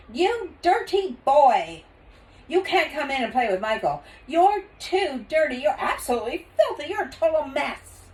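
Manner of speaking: high, loud scolding; scolding mother. Acted. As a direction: tell him off for the mess.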